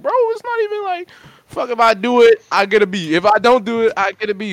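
high-pitched, exasperated mock protest; retold yelling back. Child arguing with mom over a grade, retold yelling. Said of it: "I'm yelling, we all yelling".

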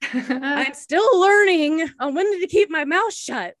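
high, slow, loud sing-song; sheepish admission. Retold or imitated. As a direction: confess it with a grin. Sheepish reply to husband.

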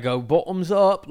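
exaggerated British drinking call; put-on British accent. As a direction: slowly cheer it in a mock British accent. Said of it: "people that speak like that".

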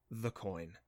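low, hushed and tight; a father's quiet demand through his teeth; hushed whisper. A narrator's reading. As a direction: hold the anger down to a near whisper. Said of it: "he whispered at Adam".

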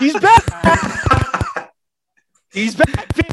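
hyped-up yell; shouting the good news; screaming. Real, not acted. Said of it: "the one that's screaming and blowing out his audio".

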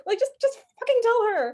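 high, loud exasperated plea; frustrated viewer's outburst; screaming at the TV. Retold or imitated. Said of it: "we were screaming at the T V".

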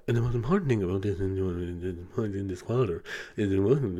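mock manager fading out, muffled and far away; faint muffled audio. Imitating a manager cutting out on a call. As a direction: voice drops away like a bad Zoom line. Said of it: "couldn't hear them very well".

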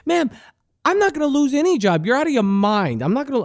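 high, fast mock defiance; heated comeback. Acted comeback in heated quarrel.

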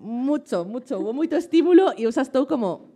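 giddy, high-pitched and laughing; babbling through nervous laughter; stressed. Real, not acted. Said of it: "esta eres tú con enerxía baixa".

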